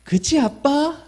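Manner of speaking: lightly voiced little girl asking her dad; child asking dad. Shown as a demonstration. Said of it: "여러분, 한번 해볼까요?".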